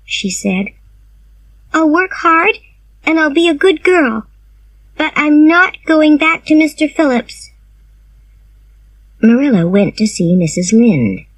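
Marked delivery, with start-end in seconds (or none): 1.7-7.5 put-on girlish voice pitched well up, earnest; high schoolgirl declaring she won't go back; young Anne voice. A narrator's reading. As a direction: read Anne as a high young girl, firm and resolved. Anne's voice in read story.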